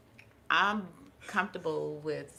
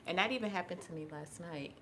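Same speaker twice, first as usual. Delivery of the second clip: normal talking voice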